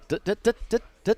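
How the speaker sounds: high, rapid nervous dut-dut sounds; playing himself cornered by coworkers, high sputtering; flustered stammer noise. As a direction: sputter like you can't find words. Flustered reaction to the staring guys.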